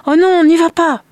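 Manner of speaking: very high, loud plea; alarmed protest. A narrator's reading. Mother protests as father leaves, alarmed. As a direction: cry out to stop him, panicked. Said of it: "protesta la mère".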